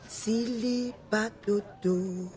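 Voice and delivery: slow, deliberate password for him to repeat; giving a secret password slowly, word by word; password spelled out slowly. Shown as a demonstration. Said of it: "Repeat this password".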